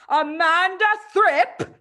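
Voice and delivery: high, loud, slow bellow across the playground; shouting. Acted. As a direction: roar the girl's name like a drill sergeant. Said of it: "she was shouting".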